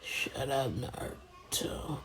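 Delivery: very soft, sleepy whisper; hushed and drawn out, half asleep; Sasuke. Acted. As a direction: mumble it faintly, trailing into a slow whisper. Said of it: "and then Sasuke yells".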